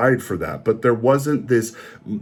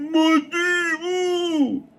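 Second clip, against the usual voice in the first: high, slow, hammed-up zealot cry; mock Stilgar as a crazy zealot, much higher and drawn out; much slower than usual. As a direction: ham up a crazed fanatic's outburst. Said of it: "you make Stilgar a crazy zealot who's just like".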